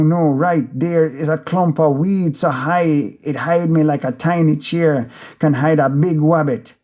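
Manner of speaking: ordinary delivery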